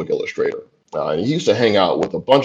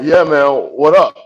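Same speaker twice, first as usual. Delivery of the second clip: loud, casual, higher "yeah man what up"; casual street greeting; 6 semitones higher, 6 dB louder than usual. Retold or imitated. Nervous young man greeting the tough neighborhood guy, casually. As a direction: play it cool and easy, a bit loud. Playing it cool with stranger.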